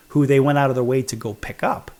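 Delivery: normal talking voice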